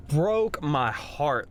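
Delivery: drawn out, each word weighed; slow heartbroken. Real, not acted. Father recalling his son, slow and heartbroken. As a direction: let the words sink slowly.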